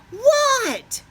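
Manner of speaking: high, loud startled what. Acted. Froggy startled by his name, high loud what.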